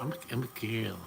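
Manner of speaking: a hint of a put-on feminine voice, mocking; half-hearted mock falsetto; mocking imitation. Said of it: "and come up".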